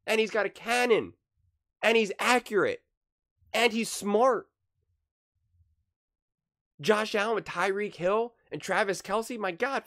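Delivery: high, loud and hyped up; excited. Real, not acted. Vlogger raving to viewers, high and loud. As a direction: gush about him like a fan.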